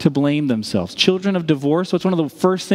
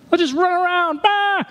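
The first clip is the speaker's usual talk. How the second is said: rowdy football players. Retold or imitated. Preacher mimics rowdy football team, hammed-up shout. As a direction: ham up a wild team yelling. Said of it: "like an M U football practice".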